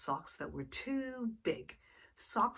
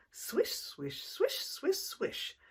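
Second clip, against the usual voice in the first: quick swishes, jumping higher; fast swishes; 10 semitones higher, slower than usual. Acted. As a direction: whip the swishes up high and fast. Fast circles.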